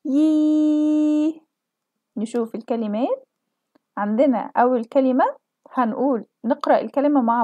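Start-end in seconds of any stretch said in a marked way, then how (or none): 0.1-1.4 يي held long for four counts; teacher holding the long ya vowel. Shown as a demonstration. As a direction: stretch يي out evenly. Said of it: "هنكسر صوتنا وهنطول فيه أربع عدات".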